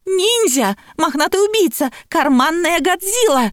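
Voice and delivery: high-pitched alarmed wailing; mock panicked yells. A narrator's reading. Lapulya raising the alarm over an unknown beast, panicked high cries. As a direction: cry out in exaggerated alarm at an intruder. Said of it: "бились вопли Лапули".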